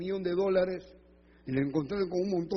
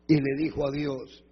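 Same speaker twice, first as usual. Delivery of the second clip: ordinary delivery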